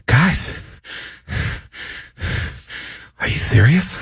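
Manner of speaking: breathy sigh then a stressed-out "Guys, are you serious?"; stressed, out of breath, few words at a time; Mark Wahlberg impression. Shown as a demonstration. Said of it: "say a few words at a time".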